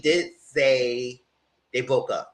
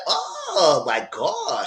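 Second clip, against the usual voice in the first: shocked; 7 semitones higher, much slower than usual. Retold or imitated. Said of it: "And I said".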